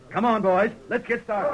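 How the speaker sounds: loud rallying call to ride. Acted. Sheriff to his posse as they ride out, loud call. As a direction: get the men moving.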